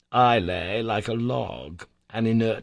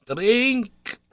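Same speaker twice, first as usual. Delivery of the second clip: much higher, louder, firm command; uncle insisting; 13 semitones higher, 5 dB louder than usual. A narrator's reading. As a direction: press him, no arguing. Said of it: "he said again".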